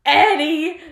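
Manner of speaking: loud, high cry of a name; acted alarmed call. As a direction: call out as if rushing to a friend. Richie calls out to Eddie.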